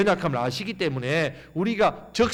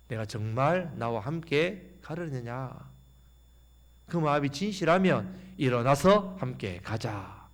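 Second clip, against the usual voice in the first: softer, slow, lower questioning; gentle asking; 4 semitones lower, 5 dB quieter, slower than usual. Pretend. The Lord gently asking the believer, soft and slow. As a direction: ask it softly, like a tender plea. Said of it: "그렇게 사랑으로 말씀합니다".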